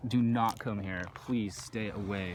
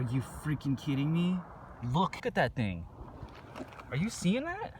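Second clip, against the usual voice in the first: excited; 8 semitones higher, 3 dB quieter than usual. Real, not acted. Thrilled at banded agate find.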